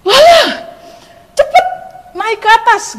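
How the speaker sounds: high, loud, hurrying call; urgent calling out. Retold or imitated. Uncle hurries niece upstairs, urgent shout. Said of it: "Om saya langsung bilang kayak gini".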